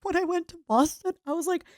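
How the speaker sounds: ordinary talk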